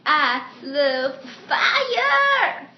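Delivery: drawn-out yell, high and very loud; very slow, shouted cartoonish declaration; Dave. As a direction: belt it out, stretching the last word. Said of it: "Dave, his voice".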